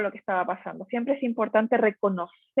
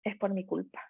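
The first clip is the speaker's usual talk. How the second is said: much softer, hushed "Es por mi culpa" as a guilty thought; child's inner thought; 3 semitones lower, 9 dB quieter than usual. Retold or imitated. Child secretly thinking mom's sadness is its fault, spoken softly. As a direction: murmur it like a child blaming itself. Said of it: "un niño o una niña automáticamente piensa".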